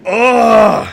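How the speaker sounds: loud, high, exasperated groan; frustrated groan. Real, not acted. Facing a choice the game forces on him, a loud high frustrated groan.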